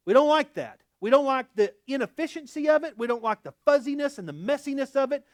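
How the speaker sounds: loud, rising sermon delivery; preaching at full force. Acted. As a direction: preach it hard to the congregation.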